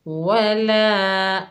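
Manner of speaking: वला with the final आ held one alif long, very slow; stretched recitation. Shown as a demonstration. Teacher showing the madd to students, drawn-out recitation. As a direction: hold the ला of वला in a slow chant. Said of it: "एक अलिफ की मिकदार खींच कर पढ़ेंगे".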